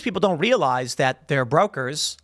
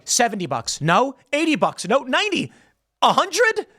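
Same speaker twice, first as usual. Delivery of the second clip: growing desperate, each bid higher; rising panic; 9 semitones higher than usual. Retold or imitated. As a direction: pitch climbing higher with each offer. Hedge funds bid desperately higher.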